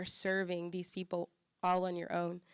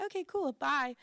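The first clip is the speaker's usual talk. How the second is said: breezy brush-off; 6 semitones higher than usual. Retold or imitated. Stranger dismissing her.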